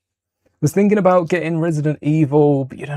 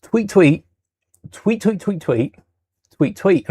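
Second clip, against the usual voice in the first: high chirpy tweet tweet tweet; playful birdie chirping; 4 semitones higher, slower than usual. Retold or imitated. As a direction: chirp it like a little bird at the window. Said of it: "a little birdie told me, and told me".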